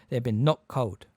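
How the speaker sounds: plain reading voice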